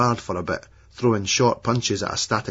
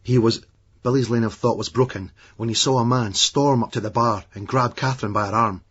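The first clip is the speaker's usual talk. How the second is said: usual speaking voice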